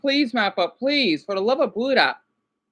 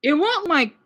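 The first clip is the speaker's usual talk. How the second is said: ordinary talk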